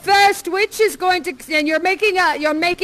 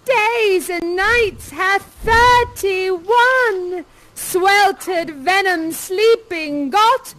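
slow, swooping theatrical incantation; first witch; 3 semitones higher, much slower than usual. Acted. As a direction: relish each word like a witch over her spell. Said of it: "first witch says".

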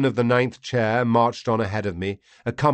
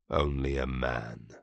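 much lower and softer, slowish; dismissive alien lord; 9 semitones lower, 9 dB quieter than usual. Acted. Vati dismissing the fallen prisoner, low and soft. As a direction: brush him off as nothing.

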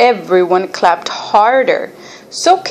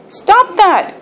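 high, sharp scolding hiss; 11 semitones higher than usual. A narrator's reading. Tree scolding the worm leaning on it, hissing. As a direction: hiss a high-pitched telling-off. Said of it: "hissed the tree".

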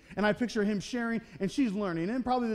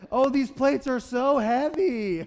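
slow, higher and louder, exaggerated strain; passive-aggressive complaint; 5 semitones higher, 6 dB louder, slower than usual. Martha hauling plates past Mary, loudly complaining for help. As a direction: ham up the heavy plates so she notices. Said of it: "dropping those little passive-aggressive hints".

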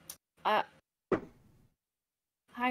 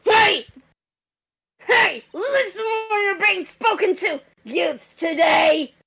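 put-on shouting old guard; 3 semitones higher than usual. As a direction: bark at the kids to listen. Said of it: "blow out your throat like that".

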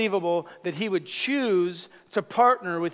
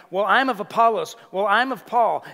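competitive boasting; 3 semitones higher, 5 dB louder than usual. Acted. Preacher mimicking rival church factions, competitive boasting. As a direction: imitate rivals trying to outdo each other. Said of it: "The competitive spirit started rising up".

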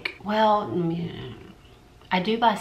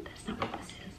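whisper; 14 dB quieter than usual. Real, not acted. Aside while reading color name.

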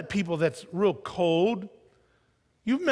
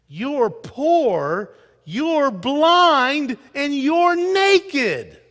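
rising louder and higher, naked drawn out slowly; building denunciation; 6 semitones higher, 7 dB louder, much slower than usual. Acted. Quoting Jesus to a lukewarm church, building loud slow charge. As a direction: build each charge until the last one rings.

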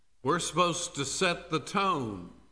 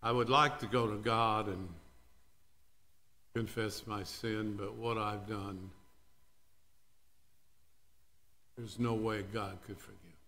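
much softer, low confession; guilty whisper of doubt; 6 semitones lower, 6 dB quieter than usual. Retold or imitated. Believer doubting forgiveness, soft and low. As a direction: confide the shame quietly.

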